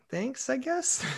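high, hesitant acceptance; mock-sheepish high voice; awkward thanks. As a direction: thank them, unsure it's a compliment. Awkward reply to odd praise.

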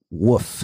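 "Woof", rhyming with hoof; "wolf" as "woof". Shown as a demonstration. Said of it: "What about W O L F?".

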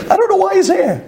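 laughing still. Real, not acted. Rabbi still laughing at crying with a stranger. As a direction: say it while the laugh fades.

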